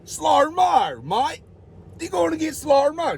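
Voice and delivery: high mock New Zealand accent on slow-mo mate; retry the same line as a Kiwi, pitched high. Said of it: "That's New Zealand, is it?".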